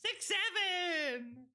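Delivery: high, drawn-out goofy call; meme call. Acted. Streamer jokes about sixty-seven seconds, high drawn-out meme call. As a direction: sing it out long and high. Chanting a meme after laughing.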